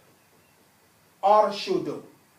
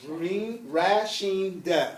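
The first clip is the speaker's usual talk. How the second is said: slow, drawn-out pieces with gaps between Rin, Rashin and da; sounded out in parts; 4 semitones lower, 5 dB quieter, much slower than usual. Shown as a demonstration. Teacher spelling out a word for students to look up, sounded out slowly. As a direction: stretch Rin and Rashin, then a short da.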